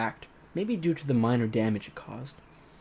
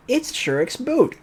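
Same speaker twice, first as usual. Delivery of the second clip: high, slow show-off line; boastful; 12 semitones higher, 4 dB louder, slower than usual. Acted. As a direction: proudly present it. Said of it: "Electronic boastfully shook it".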